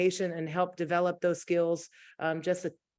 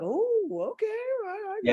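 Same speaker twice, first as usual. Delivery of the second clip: reluctant go-along; 13 semitones higher than usual. Retold or imitated. Teacher imitating kids giving in, high and hesitant. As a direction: play the hesitant kid who gives in. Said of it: "other people are like".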